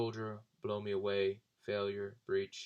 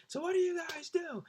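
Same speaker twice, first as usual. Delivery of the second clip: much higher mock voice; Brian asking; 3 dB louder than usual. Brian asks the two friends, high mock voice. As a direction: chirp it high like the big drunk guy. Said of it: "he's like".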